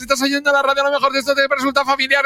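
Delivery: on the held note. Shown as a demonstration. Commentator chatting mid-goal-cry, high sustained. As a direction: keep talking on the held shout pitch. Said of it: "talks on held note".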